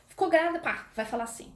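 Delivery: ordinary talk, nothing marked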